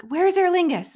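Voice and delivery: loud, high, desperate demand; frantic question. Retold or imitated. Casting director hunts for airline at next terminal, frantic loud question. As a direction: fire the question out, voice leaping up.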